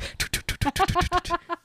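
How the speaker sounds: rapid high tut-tut-tut patter; quick little run of clipped footstep noises; imitated speedy footsteps. Host imitates someone rushing towards the office, rapid patter. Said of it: "so speedy footsteps coming towards him".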